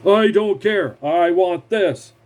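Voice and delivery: high, slow, insistent demand; mock a pig-headed boss digging in; stubborn demand. Acted quote of demanding boss.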